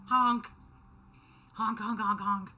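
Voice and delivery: loud honking goose imitation. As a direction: honk like a goose, loud and silly.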